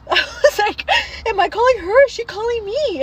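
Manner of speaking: high, loud, gasping re-enactment; hammed-up panic, pitch shooting way up; mock panic. Daughter re-enacting seeing mom's face on the phone: high, panicked.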